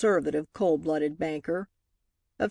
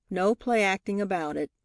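plain reading voice

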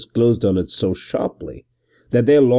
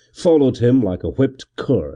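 ordinary delivery, nothing marked

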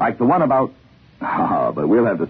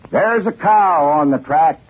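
slower, higher folksy conductor; conductor's voice; 7 semitones higher, slower than usual. Retold or imitated. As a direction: drawl it out like a country conductor. Narrator voices the train conductor.

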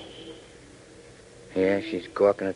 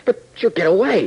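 higher, louder urgent protest; alarmed protest; 9 semitones higher, 6 dB louder than usual. Acted. Tank fearing Carla escapes, alarmed loud protest. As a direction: push back in alarm. Afraid to lose their suspect.

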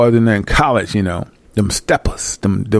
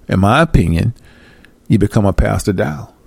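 usual speaking voice

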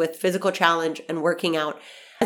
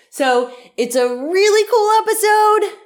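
excited; 13 semitones higher, 7 dB louder than usual. Real, not acted. Enthusiastic podcast sign-off.

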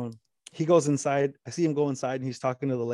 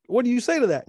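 much higher, louder rhetorical question; helpless exclamation; 10 semitones higher, 5 dB louder than usual. Retold or imitated. Storyteller at a loss after the man broke down, helpless exclamation. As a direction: throw it out high, at a loss for an answer. Storyteller reacting to man's breakdown.

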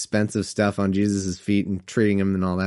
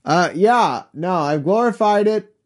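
higher, half-hearted call from a distracted God; improvised reply pitched up; mock yell back as an awkward God; 9 semitones higher, slower than usual. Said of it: "God just decides to yell back".

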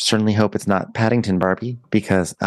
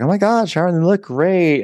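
gushing greeting; 10 semitones higher than usual. Retold or imitated. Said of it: "everyone's like".

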